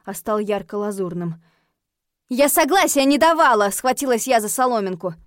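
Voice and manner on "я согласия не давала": high, loud objection; heated, raised denial; indignant protest. A narrator's reading. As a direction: protest urgently, grasping at an excuse. Heroine protests the accidental marriage.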